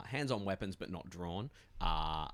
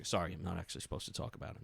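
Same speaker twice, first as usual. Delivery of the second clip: guard; much faster than usual. Acted. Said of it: "and they go".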